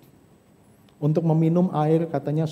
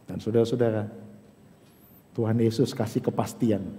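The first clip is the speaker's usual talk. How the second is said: hushed sincere preaching; 8 semitones lower, 6 dB quieter than usual. Acted.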